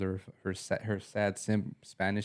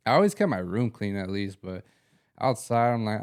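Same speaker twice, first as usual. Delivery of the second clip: normal talking voice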